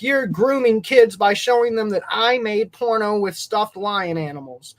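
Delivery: high, slow, mocking whine; mocking whiny voice. Mocking the movie reviewer in a whiny high voice. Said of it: "And he was like".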